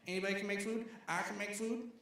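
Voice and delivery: high-pitched amazed questioning; impression of Sheik. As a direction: imitate a neighbor who can hardly believe it. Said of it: "this is a great impression".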